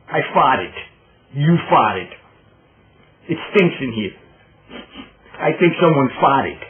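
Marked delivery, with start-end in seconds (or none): none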